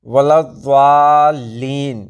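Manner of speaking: वलद्दालीन drawn out, heavy sound between d and z; slow Arabic recitation, वलद्दालीन with heavy dad. Shown as a demonstration. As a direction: recite वलद्दालीन very slowly with a long emphatic dwaa. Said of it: "दाल और जाल दोनों के बीच की आवाज़".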